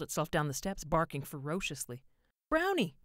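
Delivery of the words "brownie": much higher, loud shout of the dog's name. A narrator's reading. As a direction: call the dog out loud. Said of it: "George shouted".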